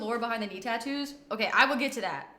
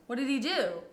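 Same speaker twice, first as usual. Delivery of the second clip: usual speaking voice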